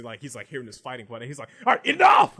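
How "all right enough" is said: high, very loud outburst; irritated shout. Acted. Irritated by the fighting he hears, shouting. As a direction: bellow to break up a fight. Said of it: "getting irritated as he".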